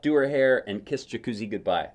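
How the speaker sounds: plain reading voice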